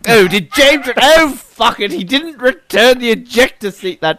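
high, fed-up muttering storeman; exasperated quartermaster. Retold or imitated. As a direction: grumble like a harried equipment clerk.

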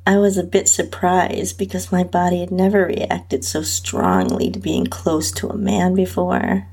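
ordinary delivery, nothing marked

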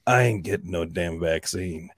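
low, slow breathy whisper; breathy whisper with accent. Shown as a demonstration. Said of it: "you have to say it with, like, a breathy voice and a little bit of an accent".

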